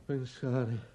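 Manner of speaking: soft, low, broken into halting phrases; quiet remorse. Acted. Father blaming himself before Belinda, low and remorseful. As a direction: sink into self-reproach.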